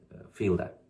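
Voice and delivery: ordinary delivery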